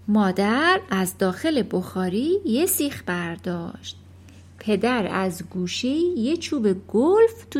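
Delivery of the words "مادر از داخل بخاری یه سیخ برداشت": plain reading voice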